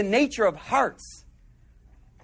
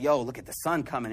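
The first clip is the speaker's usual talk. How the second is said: hushed pointing out; 9 semitones lower, 6 dB quieter than usual. Retold or imitated. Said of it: "He's like".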